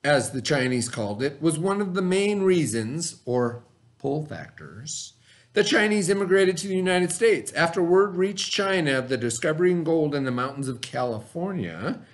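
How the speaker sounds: steady read-aloud of the textbook. A narrator's reading. Teacher reading the lesson text to the class, read-aloud voice.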